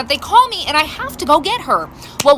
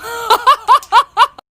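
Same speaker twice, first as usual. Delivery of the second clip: laughing; 10 semitones higher, 6 dB louder than usual. Real, not acted. Laughing after her story.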